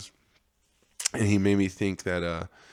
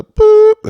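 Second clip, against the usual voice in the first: high, loud mock censor bleep; a loud cartoon bleep; bleep sound; 15 dB louder than usual. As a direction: squeak a bleep over the name. Sound effect for bleeped name.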